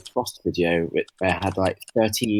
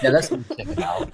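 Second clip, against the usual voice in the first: laughing; 10 semitones higher than usual. Real, not acted. Joking about checking the sponsor.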